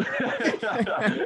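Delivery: bright laugh; high giggle burst; high burst of laughter. Real, not acted. Laughs at the memory.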